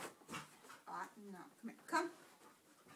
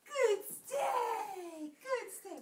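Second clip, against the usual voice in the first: excited praise; 9 semitones higher, 6 dB louder, much slower than usual. Real, not acted. Praising dog for staying.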